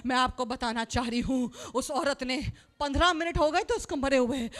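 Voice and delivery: ordinary delivery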